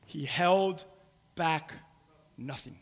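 "held", "back", "nothing" separated by pauses; word-by-word emphasis. Real, not acted. Repeats the key phrase word by word.

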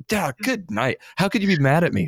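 high, exaggerated disbelief; mock incredulous. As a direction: play it as a retold "you can't be serious". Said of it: "I'm like".

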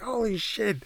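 high, awed gasp of disbelief; jaw-dropped first viewing reaction; stunned holy shit. Retold or imitated. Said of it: "I was like".

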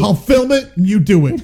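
high, loud brusque director; Gary Marshall. Retold or imitated. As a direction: director impression, rushing the take. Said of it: "Gary Marshall was like".

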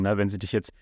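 usual speaking voice

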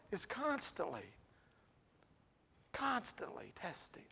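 slow, very soft preaching, near whisper; hushed preaching. Real, not acted. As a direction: let each phrase sink in, slowly and quietly. Preacher drops to a hush.

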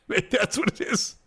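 said through a high laugh. Real, not acted. Streamer laughs off his diet anger, laughing. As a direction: giggle the words out.

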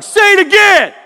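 very high, loud retold yell; enraged shout. Speaker answering insults from another driver, high enraged shout. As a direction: yell back in a hammed-up rage.

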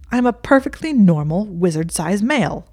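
loud offended insistence; indignant. A narrator's reading. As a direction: bristle and defend yourself. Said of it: "looking enraged".

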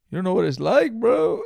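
high, loud whiny bro voice; hammed-up hurt complaint; mock whine. Teasing a friend over a tossed sweater, high mock whine.

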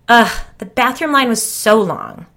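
high, loud exasperated; cheery complaint. Acted. Jen returning from the bathroom, loud breezy complaint. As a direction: breeze back in.

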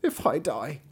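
lightly acted weeping plea; weeping woman, acted. A narrator's reading. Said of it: "wept and said".

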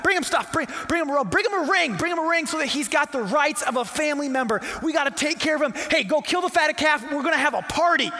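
high, eager string of orders; excited dad. Acted. Father ordering a feast for his son, excited. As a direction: keep piling on orders, thrilled.

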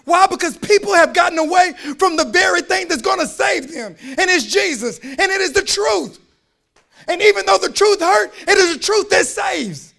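building from firm to a loud, high shout; full-force preaching. Acted. Preacher warning the church, building to a shouted sermon.

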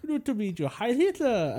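high, clipped-free mock German officer voice; hammed-up Nazi officer greeting; SS officer impression. Podcast host imitating the SS officer character, mock German voice. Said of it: "a fantastic character in a, an S S officer".

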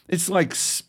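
normal talking voice

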